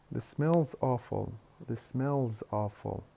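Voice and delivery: slow "smell's" with the s joined on as z; fuse "is" onto "smell" with no vowel; attached contraction. Shown as a demonstration. Teacher showing choice A, "smell's" said as one syllable. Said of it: "We simply attach, attach it".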